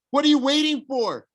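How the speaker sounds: yelling. A narrator's reading. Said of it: "Clarice yelled".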